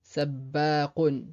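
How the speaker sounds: Sabbaqun lentement, b doublé; Sabbaqun, appuyer sur le bb; doubled b. Shown as a demonstration. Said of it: "la même chose avec d'autres mots". (Sabbaqun avec shadda, b double)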